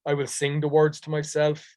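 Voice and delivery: ordinary talk, nothing marked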